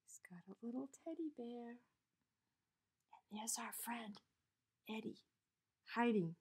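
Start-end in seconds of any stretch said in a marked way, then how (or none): 0.0-5.2 fast, soft whisper. A narrator's reading. Reader shows children Eddie hiding, whispering. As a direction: hush so the bear won't hear.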